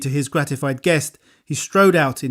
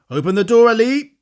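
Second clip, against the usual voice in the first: louder, higher call; 7 semitones higher, 6 dB louder than usual. A narrator's reading. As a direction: call down the hall. Bones calls to servant.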